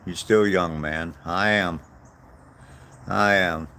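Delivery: ordinary talk, nothing marked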